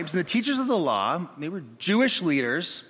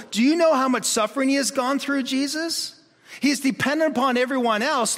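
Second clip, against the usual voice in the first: higher, urgent pleading friend talking to Jesus; 6 semitones higher than usual. Retold or imitated. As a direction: beg Jesus, rushing as it builds. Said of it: "the response in the hearts and minds ... of the four friends".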